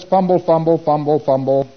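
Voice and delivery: high, loud run of repeated fumbles; fumbling preclear. Acted. Auditor's client fumbles for an answer, in mock fumbling. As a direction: lightly mimic a fumbling client, stepping down in pitch. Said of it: "And the P C says".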